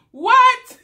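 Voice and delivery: high, loud disbelieving what; loud incredulous what. Real, not acted. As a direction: blurt it out in disbelief. Incredulous reaction.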